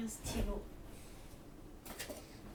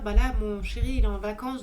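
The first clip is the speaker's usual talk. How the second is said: louder; 11 dB louder than usual. Real, not acted. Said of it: "je peux parler un peu plus fort".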